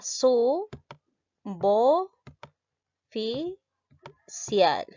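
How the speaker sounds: su, bo, fi, cial with pauses between; teacher spelling out syllables su, bo, fi, cial. Shown as a demonstration. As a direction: split su bo fi cial slowly, one beat each. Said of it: "el silabeo sería".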